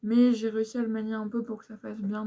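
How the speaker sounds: ordinary talk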